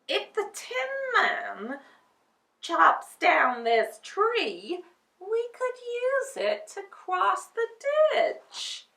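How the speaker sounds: excited idea, drawn out; scarecrow with an idea. Acted.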